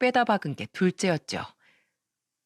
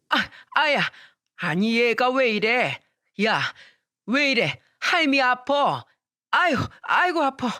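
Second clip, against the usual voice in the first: put-on old woman in pain; 4 semitones higher, slower than usual. Grandmother hit by boy.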